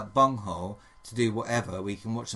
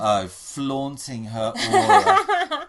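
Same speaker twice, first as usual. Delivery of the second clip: laughing mockery; 10 semitones higher, 8 dB louder, much slower than usual. Real, not acted. Mocking the housemate's remark.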